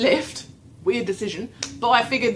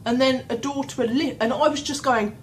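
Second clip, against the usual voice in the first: normal talking voice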